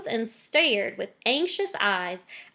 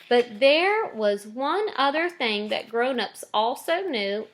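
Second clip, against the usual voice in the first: ordinary delivery, nothing marked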